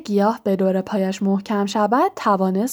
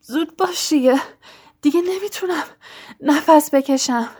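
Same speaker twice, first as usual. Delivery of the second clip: higher, gasping for air as the stem squeezes his chest; choking out the words, pleading for speed; out of breath and pleading, half-choked; breathless plea; 6 semitones higher than usual. A narrator's reading. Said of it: "نفس نفس زنان گفت".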